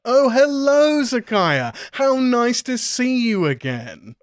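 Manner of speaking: high, slow, sugary greeting; a sly host welcoming an old friend back; high character voice. Acted. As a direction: greet her sweetly, stretched out and high.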